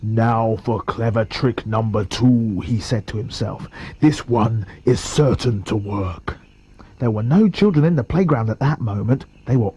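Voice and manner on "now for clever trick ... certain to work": low soft villain muttering; scheming crocodile. Acted. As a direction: plot it to yourself with relish. Crocodile plotting to himself.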